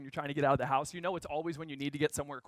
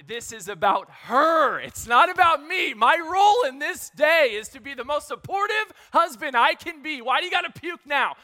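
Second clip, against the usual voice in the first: higher, louder, self-scolding shout; frantic inner shout; 8 semitones higher, 11 dB louder than usual. Retold or imitated. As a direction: yell at yourself in panic. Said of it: "I'm looking at my wife going".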